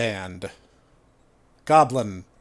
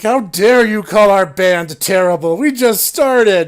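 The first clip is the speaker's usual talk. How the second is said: loud, high offended yell; indignant shout; 5 semitones higher, 9 dB louder than usual. Retold or imitated. Bandmate yelling at the player, indignant shout. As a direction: shout back in outrage at the insult. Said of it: "this random person shouts at me".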